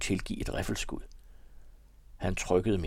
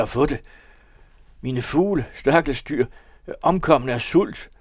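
louder, higher, desperate confession; distraught Halvor pleading; 4 semitones higher, 5 dB louder than usual. Acted. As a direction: pour out guilt and jealousy, begging forgiveness. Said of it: "Stemmen var brudt". (fortvivlet, højere og kraftig tilståelse)

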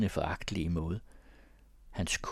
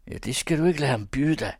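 higher, louder egging on; goading; 7 semitones higher, 5 dB louder, faster than usual. A narrator's reading. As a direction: needle him into fighting. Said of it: "tirrede Jesper".

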